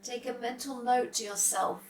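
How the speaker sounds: calm, unhurried meditation voice with long pauses; soft, settled yoga nidra guidance; guided meditation. Real, not acted.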